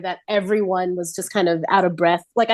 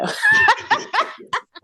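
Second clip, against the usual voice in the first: high laugh. Real, not acted. Laughing at own joke.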